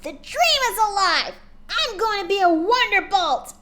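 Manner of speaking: loud, high victory cheer. A narrator's reading. Pony cheering she will fly with the team, loud and high. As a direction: shout it to the sky.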